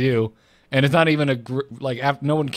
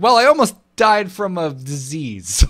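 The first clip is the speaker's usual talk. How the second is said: louder, higher, drawn-out declaration; mock dramatic announcement; 5 semitones higher, 5 dB louder than usual. Host summing up his illness story, mock dramatic. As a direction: blurt it out slowly for comic effect. Acting out the long story's punchline.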